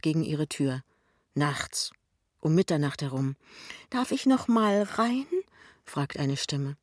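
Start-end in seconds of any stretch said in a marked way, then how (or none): 3.9-5.3 high, drawn-up asking voice; the mother's higher-pitched voice, rising into a question; voce della madre. A narrator's reading. Mother knocking, asks to come in.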